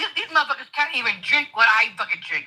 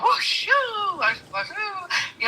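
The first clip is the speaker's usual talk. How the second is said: breathing out the anger; calming breaths; 5 semitones higher, much slower than usual. Real, not acted. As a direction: blow out slow shushing breaths to calm down. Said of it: "let me calm down".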